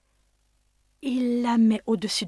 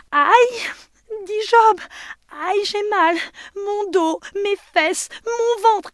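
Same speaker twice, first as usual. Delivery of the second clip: yelping in pain; 13 semitones higher, 8 dB louder, slower than usual. Acted. Job beaten by stick.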